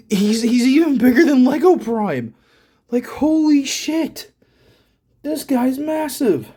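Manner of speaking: amazed. Real, not acted. Amazed at the huge figure.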